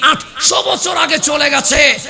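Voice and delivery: loud, rousing sermon voice; fiery preaching. Acted. Preacher rousing the gathering about Abdul Qadir Jilani, fiery sermon voice. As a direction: preach it out to the whole crowd. Preacher addressing the waz crowd.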